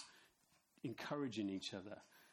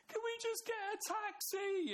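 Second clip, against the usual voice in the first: put-on very high, crying little girl's plea; high mock child pleading; daughter's wail; 4 dB louder than usual. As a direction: mimic the tired eight-year-old begging. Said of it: "she shouted".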